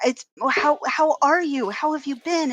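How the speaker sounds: high-pitched, delighted fussing over someone; effusive greeting. Retold or imitated. Man at the bar pretends to know a stranger, effusive. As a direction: imitate an overjoyed long-lost friend. Stranger keeps up fake reunion.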